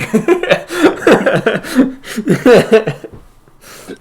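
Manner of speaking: loud, high burst of laughter; real laughing fit. Real, not acted. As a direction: crack up helplessly for several seconds. Game master cracks up after joke.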